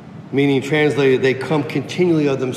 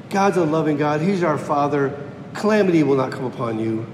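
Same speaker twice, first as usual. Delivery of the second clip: ordinary talk, nothing marked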